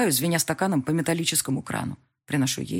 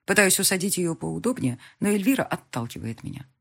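usual speaking voice

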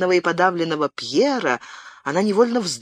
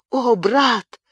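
moaning; 8 semitones higher than usual. A narrator's reading. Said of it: "со стоном перебила его Бабетта".

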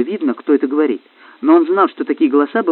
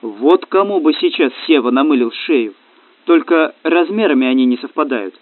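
ordinary delivery

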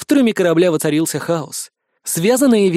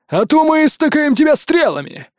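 guard threat; 9 semitones higher, 3 dB louder than usual. A narrator's reading.

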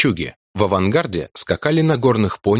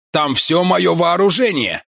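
Martin's voice; 9 semitones higher, slower than usual. Acted. Martin shouting while running.

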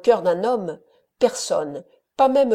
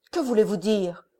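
ordinary delivery

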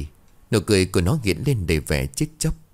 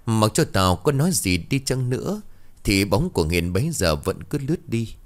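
ordinary delivery, nothing marked

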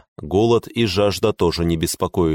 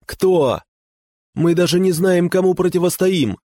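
high panicked shouting; 11 semitones higher, 3 dB louder than usual. A narrator's reading. Panicked companion shouts back.

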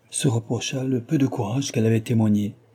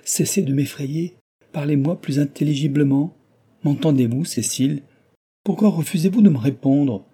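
alarmed pleading; 5 semitones higher, 5 dB louder than usual. Acted. Alarmed lover pleads with Cécile.

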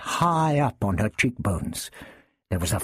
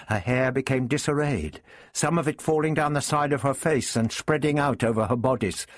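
ordinary delivery

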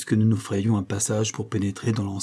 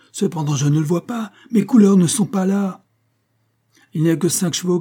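high and louder, rising panic; agitated; 7 semitones higher, 5 dB louder than usual. A narrator's reading. Colonel agitated, looking for his colours. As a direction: voice climbing in alarm. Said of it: "au paroxysme de l'agitation".

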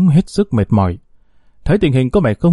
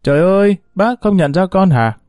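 fake delighted cry; 8 semitones higher than usual. A narrator's reading. Mistress greets the old mother with put-on joy, higher. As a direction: gush with pretend happiness. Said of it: "làm bộ vui mừng la lên".

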